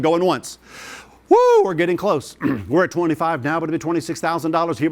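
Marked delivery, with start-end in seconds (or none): 1.3-1.6 very high, loud joyful whoop. Real, not acted. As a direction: let out a victory whoop. Auctioneer whoops at bid.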